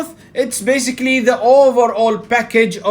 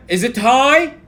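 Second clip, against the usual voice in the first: loud, raised voice with a rising end; high volume; 6 semitones higher, 5 dB louder than usual. Shown as a demonstration. Trainer showing the audience vocal volume, loud and high. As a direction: demonstrate high volume, pitched up. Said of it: "your voice volume".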